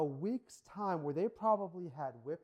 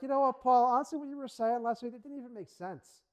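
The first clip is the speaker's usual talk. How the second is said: loud, higher, scornful heckling; mock hostile crowd; 6 semitones higher, 8 dB louder than usual. Crowd heckles Paul after last week's praise, loud mock scorn. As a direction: jeer at him like a turned crowd.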